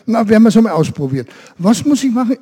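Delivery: eager goat. Acted. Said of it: "hat sie gesagt".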